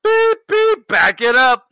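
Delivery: high loud beeping truck call; reversing truck beep. Pretend.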